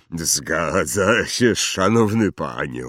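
loud, very slow giant's voice; gruff giant, respectful. Acted. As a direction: answer heavily and respectfully as a big man. (tubalny olbrzym, powoli i z szacunkiem)

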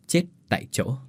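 ordinary delivery

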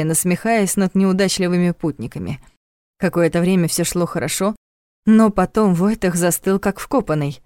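usual speaking voice

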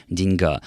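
"деньга" stressed on the final а; stress on the last syllable. Shown as a demonstration. Presenter explains borrowings to a listener, end-stressed деньГА. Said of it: "с ударением на конце".

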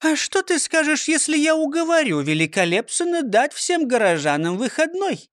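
high prim lady voice; coy, scheming old lady. A narrator's reading. Frøkinstal character voice.

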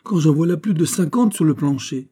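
high, loud incredulous protest; astonished exclamation. A narrator's reading. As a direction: cry out in disbelief. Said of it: "s'écria-t-il". (protestation haute et stupéfaite)

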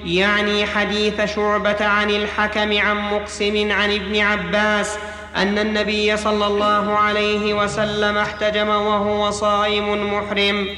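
ordinary talk

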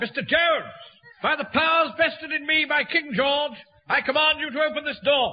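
high, proclaiming official voice; pompous command. Acted. Captain invoking the King to get the door opened, pompous command. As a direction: proclaim it grandly through a shut door.

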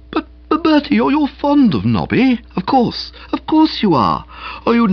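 pleading. Acted. Boko begging Bertie to help for Nobby, pleading. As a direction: beg him not to back out. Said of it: "A pleading note came into his voice".

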